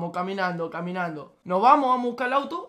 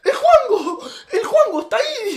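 high, loud laughing shout; 12 semitones higher, 9 dB louder than usual. Retold or imitated. Tambo yells from the car on spotting Juanjo, loud and high with laughter. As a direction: yell it out spotting a friend. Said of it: "agarra y grita".

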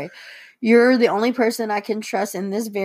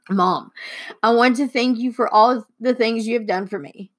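usual speaking voice

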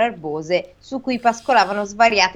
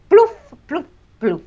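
splash sound effect; 7 semitones higher, 5 dB louder, slower than usual. Acted. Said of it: "fecero le tre frecce".

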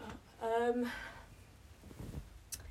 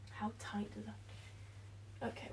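whispering; 12 dB quieter, slower than usual. Real, not acted. Whispering while working.

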